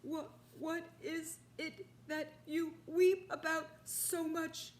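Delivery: breathless question. Acted. Father asking the rhinoceros, out of breath. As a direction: ask while being shaken.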